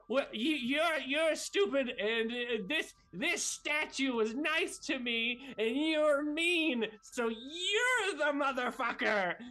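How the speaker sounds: high, stammering, loud comeback; indignant Socks Senior. Acted. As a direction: work up nerve, dragging out the insults. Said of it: "he's like".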